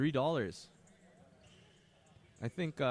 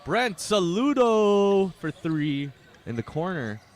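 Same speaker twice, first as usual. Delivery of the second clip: higher, louder, slow build on the corner three; excited call; 7 semitones higher, 10 dB louder, slower than usual. Acted. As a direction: sell the big shot. Commentator calls a corner three.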